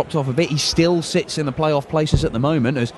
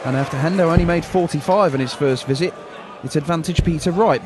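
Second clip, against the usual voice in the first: normal talking voice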